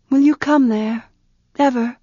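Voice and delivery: asking shyly for a promise; hopeful young woman. Acted.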